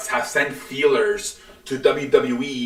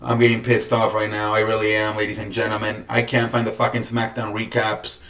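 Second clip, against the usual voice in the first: ordinary talk, nothing marked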